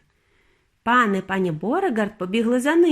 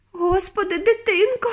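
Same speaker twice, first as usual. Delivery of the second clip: high, distressed squeak; 10 semitones higher than usual. A narrator's reading. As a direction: squeal it out like an aghast mother. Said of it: "пропищала пані Борегард".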